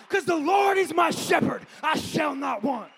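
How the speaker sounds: the psalm line shouted high, slowing on the last words. Acted. Preacher proclaiming David's faith to the congregation, shouting. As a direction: cry it out as a triumphant declaration.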